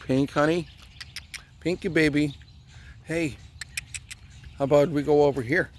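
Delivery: calling the dog. Real, not acted. Owner coaxing the dog to come over, calling out. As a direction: call out sweetly to a pet.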